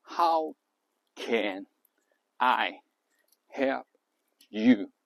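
"How, can, I, help, you" as five separate words with long pauses; isolated, evenly spaced "How can I help you". Shown as a demonstration. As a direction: give each word of "How can I help you" its own beat. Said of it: "五个词".

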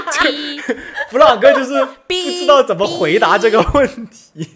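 amused, words breaking into laughter; laughing while talking. Real, not acted. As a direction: laugh helplessly as you tell it. Laughing at his awkward story.

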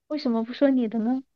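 usual speaking voice

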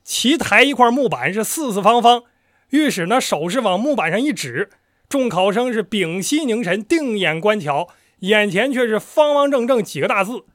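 measured, drawn-out performance; storyteller narration. Acted. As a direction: tell it like a traditional teahouse storyteller.

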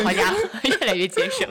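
好家伙 laughed out, 结 stressed, rising; laughing harder. Real, not acted. Repeated punchline, laughing more.

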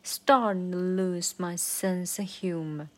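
slow line with "starting" punched; stress on starting. Shown as a demonstration. Teacher showing the line's accent, stress on "starting". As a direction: lean hard on "starting", then draw the rest out. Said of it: "Starting是一个重音".

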